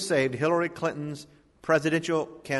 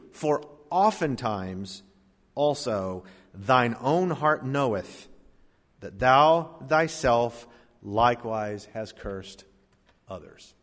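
slow, lower, fading to a hush; slow it right down and let it fall away; preacher slowing for the key verse; slow deliberate reading; 4 semitones lower, slower than usual. Acted. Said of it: "Listen to this very carefully".

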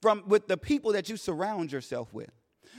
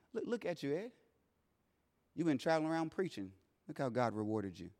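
very soft, hushed taunting voice; inner whispers; 6 semitones lower, 14 dB quieter than usual. Retold or imitated. Doubt taunting the grieving father in the hospital, hushed. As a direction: murmur the doubts under your breath. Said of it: "Whispers started coming".

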